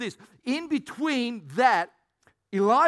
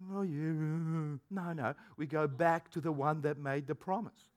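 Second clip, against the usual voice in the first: quiet inner voice; 7 semitones lower, 9 dB quieter than usual. Retold or imitated. Soft self-correcting inner answer.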